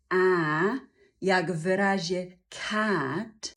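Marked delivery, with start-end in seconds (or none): 0.1-0.9 A spread wide as in cat; wide goat A. Shown as a demonstration. Teacher shows kinds of English A, wide goat-like A. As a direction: bleat A broadly, like the vowel of cat. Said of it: "szerokie kozie".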